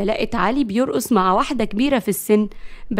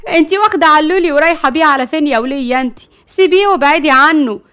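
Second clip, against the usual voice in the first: high, loud, fast challenge; indignant, rapid-fire shout; confrontational demand; 5 semitones higher, 7 dB louder than usual. Acted. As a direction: warn off a rival. Rival woman confronts the wife.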